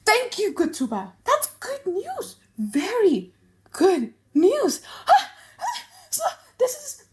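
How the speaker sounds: very high, gasping, laughing-crying voice; giddy and breathless with spiteful delight; manic joy. Acted. Shylock thanking Tubal for the news, high laughing and gasping.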